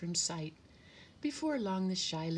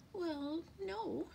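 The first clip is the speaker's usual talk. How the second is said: high, sheepish little bird voice; hesitant high-pitched sparrow; sniffing sparrow; 12 semitones higher than usual. A narrator's reading. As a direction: admit it in a high, small voice. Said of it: "sniffed the sparrow".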